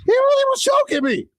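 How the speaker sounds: very high-pitched, incredulous complaint; shocked protest. Real, not acted. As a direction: protest that you were really choked, voice way up. Protests after being choked.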